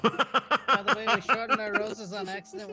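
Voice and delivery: loud, laughing confession. Real, not acted. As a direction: blurt it out through laughter. Player laughs while confessing.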